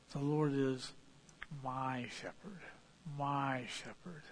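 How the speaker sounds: "my shepherd" with my leaned on, slow and soft; "my shepherd" said slowly, quietly, my weighted; stress on my. Shown as a demonstration. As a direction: press on "my" to show it is his own.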